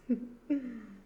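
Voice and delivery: wicked falling cackle; evil cackle. Acted. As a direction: laugh over your helpless prey. Devil gloats over hypnotized victim.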